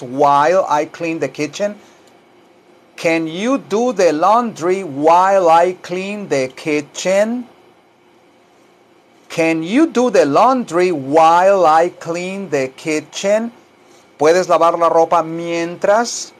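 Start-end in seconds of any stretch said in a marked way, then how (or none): 3.0-7.5 slow, drawn-out English for repeating; slow teaching model. Shown as a demonstration. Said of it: "tal como lo oye que yo lo digo". 9.3-13.5 slow, drawn-out English for repeating; slow teaching model. Shown as a demonstration. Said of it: "tal como lo oye que yo lo digo".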